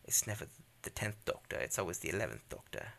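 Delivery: a bad, lightly done British accent; terrible British accent. Host shows off his Doctor voice, bad British accent. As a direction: put on a poor British accent. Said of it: "that's a terrible British accent".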